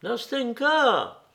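appel lent, plus aigu, qui porte; appel derrière eux. A narrator's reading. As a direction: lancer le nom de loin. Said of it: "dit une voix derrière nous". (le jeune homme l'appelle derrière eux, lentement)

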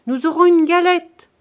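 high, loud announcement shouted across; calling out. A narrator's reading. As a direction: shout the good news to someone away. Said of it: "cria la cuisinière".

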